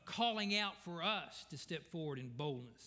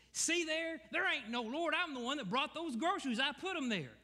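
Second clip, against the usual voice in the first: high, raised, triumphant taunt; gloating neighbour; 10 semitones higher, 3 dB louder than usual. Retold or imitated. Atheist jumping from behind the bush, high gloating. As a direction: jump out and crow about it. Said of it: "he said".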